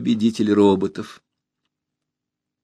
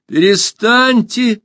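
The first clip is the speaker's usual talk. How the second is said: high, loud, drawn-out shout; urgent high-pitched yell; crying out; 12 semitones higher, 5 dB louder, much slower than usual. A narrator's reading. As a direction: shout to make them stop. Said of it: "закричала Алиса".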